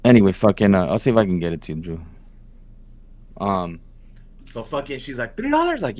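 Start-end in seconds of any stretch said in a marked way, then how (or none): 5.4-5.8 high, squeaky disbelieving question; a retold, hammed-up three dollars; shocked disbelief. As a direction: mimic her stunned reaction. Retelling the customer's shock again.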